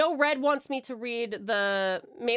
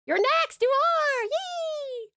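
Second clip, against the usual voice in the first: excited cheer; much slower than usual. Real, not acted. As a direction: squeal it to cheer someone on. Cheering the next writer on stream.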